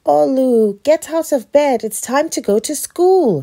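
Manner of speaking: high, loud wake-up call; mom calling out. A narrator's reading. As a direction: call a child out of bed. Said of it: "his mom called".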